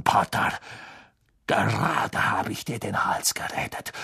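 hushed, whispery venom; quiet, breath-heavy contempt; seething taunt. A narrator's reading. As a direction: drop to a half-whisper full of spite.

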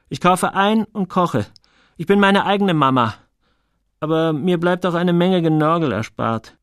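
a hint of a proud, independent boy; lightly voiced Joel, self-reliant. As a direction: lightly play a boy insisting he manages alone.

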